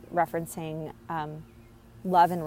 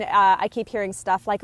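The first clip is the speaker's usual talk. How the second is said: ordinary talk, nothing marked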